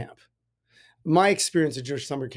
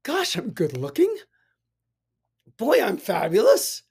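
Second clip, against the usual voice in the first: a lightly put-on preening voice, pitched higher; mirror self-praise; 5 semitones higher, 3 dB louder than usual. Telling the audience to praise themselves, mock preening. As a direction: playfully admire yourself in the mirror. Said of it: "look in the mirror and say".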